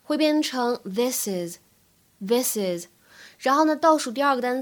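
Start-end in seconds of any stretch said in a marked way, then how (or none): 0.8-2.9 slow "this is" joined as "thi-sis"; 慢速连读this is; linked this is. Shown as a demonstration. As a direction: run the s of "this" into "is", slowly. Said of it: "this is可以做连读".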